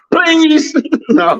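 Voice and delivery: high-pitched, through laughter; helpless high giggly voice; laughing protest. Real, not acted. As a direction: squeal it out while cracking up. Laughing after teasing remark about women.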